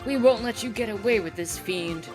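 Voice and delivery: loud, defiant stage hero; heroic shout. Acted. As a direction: call out the villain boldly. Hero defies villain in play.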